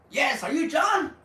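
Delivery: high, loud yes; mock cheerful meeting voice; eager greeting. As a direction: chirp back keen and ready.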